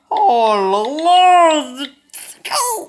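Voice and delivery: very high, drawn-out howl of dismay; exasperated wail. Real, not acted. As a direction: howl loudly, as if it's unbearable. Cracking up over another mistake. (long hurlement aigu d'exaspération)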